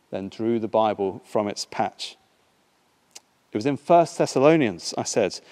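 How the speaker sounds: usual speaking voice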